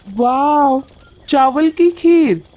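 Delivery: put-on high, excited child's cheer; delighted chick. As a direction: cheer like a little one getting a treat. Chicks delighted at rice pudding. (खुशी से चहकते चूज़े की आवाज़)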